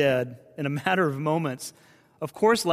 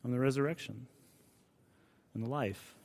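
much softer, tender hush; gentle whisper; 8 dB quieter, faster than usual. Acted. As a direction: let it fall soft as a whisper. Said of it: "Jesus whispers".

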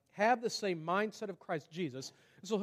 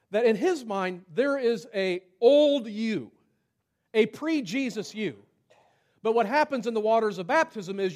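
raised, loud sermon delivery; emphatic preaching; 4 semitones higher, 8 dB louder than usual. Real, not acted. Preacher telling the church about old and new self, emphatic. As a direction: drive the point home loudly, higher pitched. Preacher drives home his point.

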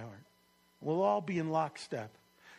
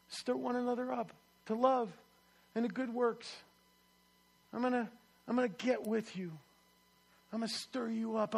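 ordinary delivery

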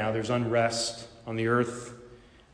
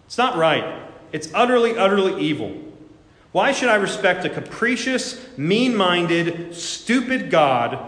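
rising indignant rant at God, much louder and higher; a preacher acting out an atheist's furious tirade against God; outraged tirade; 5 semitones higher, 6 dB louder than usual. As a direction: hurl the accusation at God with mounting fury. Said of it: "He replied".

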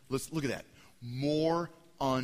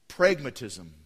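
Pragmatism said louder and deliberate; emphatic term; 3 semitones lower, 7 dB louder, slower than usual. Shown as a demonstration. Preacher names the word Pragmatism for the church, loud. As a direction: drive the word Pragmatism home. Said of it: "that's called".

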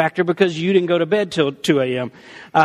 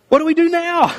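high, flustered comic thought; mock panic; 9 semitones higher, 4 dB louder than usual. Speaker privately asking the Lord for help, mock panicked. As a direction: pitch it up in playful alarm.

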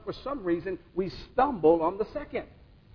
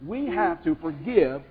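slow solemn. Real, not acted. Closing exhortation.

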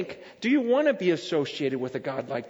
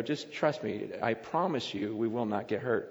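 lower, softer coaxing promise; gentle reassurance; 7 semitones lower, 7 dB quieter than usual. Retold or imitated. Dad promising his daughter the ride is safe, softly reassuring. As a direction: reassure quietly and earnestly, retold.

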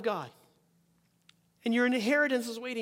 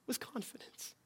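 whispered; 18 dB quieter than usual. Acted. Preacher whispering to the congregation about God. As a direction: drop to a whisper on with confidence. Sermon line dropped to a whisper.

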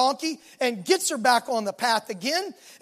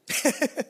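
short real laugh; 7 dB quieter than usual. Real, not acted. Preacher laughs at his own joke.

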